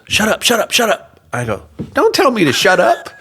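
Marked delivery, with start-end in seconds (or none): 0.1-1.2 high, rapid-fire imitation of shushing; urgent hushing. Friend urgently hushing, retold. 1.9-3.1 loud, high retold retort; indignant. Retold quarrel over shushing.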